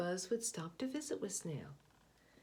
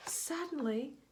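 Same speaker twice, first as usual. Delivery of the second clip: high, lifted exclamation; spring the surprise; sudden high burst of wonder; surprised; 8 semitones higher, 3 dB louder than usual. A narrator's reading. The surprise moment.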